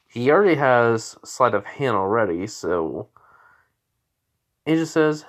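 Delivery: usual speaking voice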